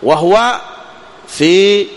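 ordinary talk, nothing marked

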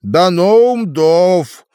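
медленно, нараспев, драконий язык; произнести «Даноум Дов» протяжно; торжественно. A narrator's reading. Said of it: "перевёл Болтун". (торжественное имя)

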